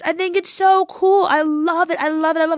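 light up over how cool it is; gushing. Real, not acted.

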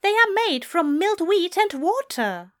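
high, loud, exasperated correction; frustrated explaining. Acted. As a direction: spell out the facts in exasperation. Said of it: "shook his head in frustration".

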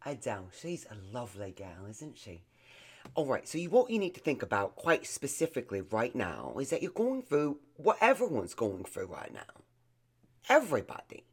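put-on Cockney therapist; Cockney accent. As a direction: counsel in a playful Cockney accent. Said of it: "a little Cockney might feel a little bit better".

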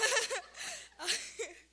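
high, loud giggle; laugh. Real, not acted. Speaker greeting people she knows, giggling.

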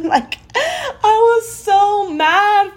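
high-pitched laughing exclamation; giggling disbelief at her own rage. Real, not acted. Laughing about medication anger.

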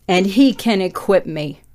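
ordinary talk

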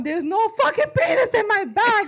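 loud, high, outraged yelling about peanuts; retold angry yell. Telling her mom off about missing peanuts, loud and outraged. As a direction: shout it like the bag betrayed you.

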